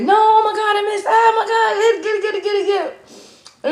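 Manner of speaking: very high frantic shriek; screaming over a spider at the roadside; panicked squeal. Retold or imitated. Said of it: "I said".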